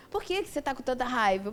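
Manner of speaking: ordinary talk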